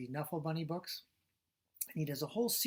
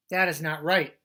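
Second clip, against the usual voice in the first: indignant; 13 dB louder than usual. Acted. Gerald indignant, read aloud.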